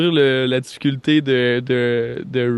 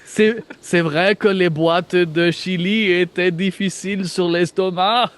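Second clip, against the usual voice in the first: put-on slow, higher German-accented French; 5 semitones higher than usual. Guard agreeing about the chili cans, German accent. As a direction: concede it slowly in a German accent. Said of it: "d'un accent allemand".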